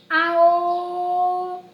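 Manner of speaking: put-on child's shout. Teacher voicing the little mole, yelling. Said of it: "gritava Topeirinha".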